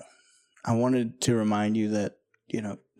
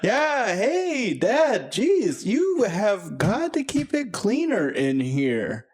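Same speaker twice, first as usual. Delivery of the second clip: higher, nagging daughter scolding her dad; teen daughter voice; 9 semitones higher, slower than usual. Acted. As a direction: chide your dad for the mess like a teenage girl.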